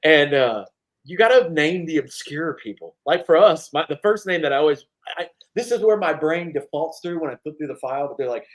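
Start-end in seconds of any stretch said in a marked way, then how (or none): none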